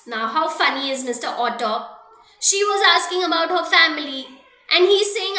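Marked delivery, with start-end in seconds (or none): none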